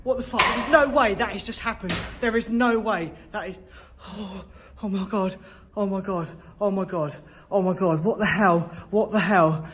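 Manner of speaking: high, loud, gasping panic; real terror, yelping out; panicked cries. Real, not acted. Ghost hunter panics after an object moves on its own. Something moved by itself.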